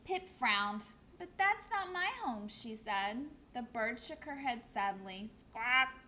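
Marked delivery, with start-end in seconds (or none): 1.2-2.5 higher, small chick voice; the lost chick, a bit let down; little penguin. Acted. 5.3-6.0 mild squawk; a quick bird squawk; gull squawk. Acted.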